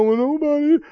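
high, teary mock whimper; mock whining. Preacher mocks a believer whining that everyone left them. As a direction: parody a pitiful crybaby. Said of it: "start telling lies and go".

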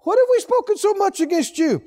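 high, insolent mock protest; disrespectful tone. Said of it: "You don't talk like this".

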